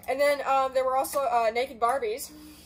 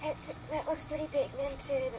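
beauty guru voice; 4 semitones higher, 4 dB quieter than usual. Pretend. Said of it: "I sound like a beauty guru".

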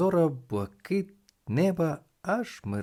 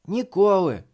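loud, high refusal; emphatic boy; 8 semitones higher, 8 dB louder than usual. A narrator's reading. Thomas answering the reporter, loud emphatic refusal.

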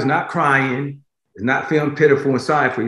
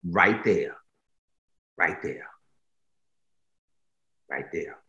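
each repeat softer and lower until nearly gone; fading to a hush; 8 semitones lower, 8 dB quieter than usual. Acted. God telling the weak one their flaw is enough, fading away. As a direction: let it fade out.

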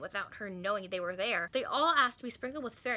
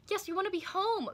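higher, eager group cry; 8 semitones higher than usual. Acted. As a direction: call out eagerly together. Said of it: "cried the rest of the girls".

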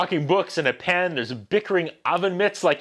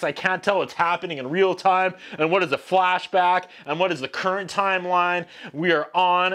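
ordinary delivery

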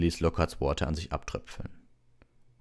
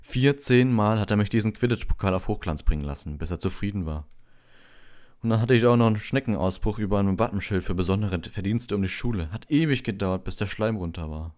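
a touch higher and louder, grumbling; lightly voiced Ron; 5 semitones higher, 6 dB louder than usual. A narrator's reading. Ron complains to Harry about polishing trophies.

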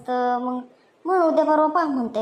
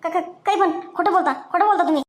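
higher, stuttering panic; shocked stammering denial; 6 semitones higher than usual. Acted. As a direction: stammer it out, caught red-handed.

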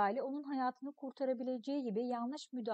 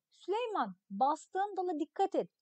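a warning called up into the tree, somewhat higher; calling out a warning; 6 semitones higher, 3 dB louder than usual. Acted. Hasan warning Süleyman up in the tree, calling out. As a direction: call a caution to someone climbing. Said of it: "Hasan ağabey bağırıyor".

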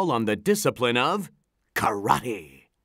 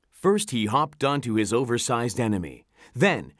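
plain reading voice

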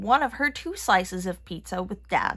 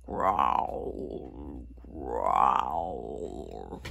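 very deep rumbling growl; stomach growl; 6 dB quieter than usual. Acted. Sisters' empty stomachs, deep rumbling growl. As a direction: rumble low like a hungry stomach. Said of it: "heard their stomachs growl".

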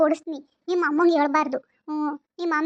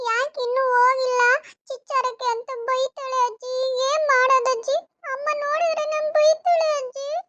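put-on high, whiny small-boy voice; little grandson; 6 semitones higher, slower than usual. Grandson whining to grandma for chocolate, high and whiny. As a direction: plead like a spoiled little boy. Grandson worried about mother.

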